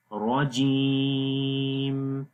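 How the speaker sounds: very slow, stretch the vowel of جیم long; elongated jeem. Shown as a demonstration. Teacher stretching the vowel for students. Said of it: "یہاں پر جیم کو لمبا کریں گے".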